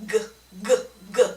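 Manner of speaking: g with the throat buzzing; "g" voiced from the back of the tongue. Shown as a demonstration. Therapist showing a parent the voiced sound: buzzing g. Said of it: "will vibrate".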